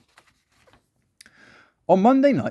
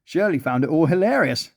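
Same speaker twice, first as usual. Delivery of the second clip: plain reading voice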